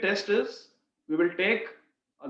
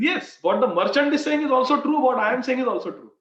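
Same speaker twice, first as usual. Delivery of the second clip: raised, loud and pressing; emphatic insistence; 6 semitones higher, 5 dB louder than usual. Retold or imitated. Devotee assuring the astonished king both are right, emphatic. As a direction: insist firmly, pitched well up.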